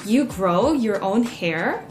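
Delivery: slow, drawn-out disbelieving question; mock incredulity. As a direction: imitate a stunned stranger, stretching each word. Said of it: "with increasing incredulity".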